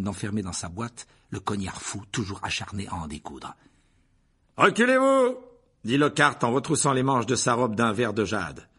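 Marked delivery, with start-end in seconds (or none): 4.6-5.4 high, loud order to step back; showy command. Acted. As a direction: wave them off grandly. Lockhart voiced by narrator.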